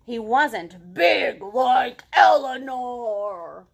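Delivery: loud, drawn-out storytelling boom; big monster emphasis. A narrator's reading. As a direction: make the size huge with long, heavy words. Acting out how big Eleanor is.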